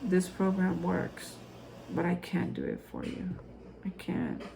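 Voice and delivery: very soft, earnest appeal; hushed sincerity. Real, not acted. Host telling struggling viewers she can't do it for them, hushed and sincere. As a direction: let it sink almost to a whisper by the end.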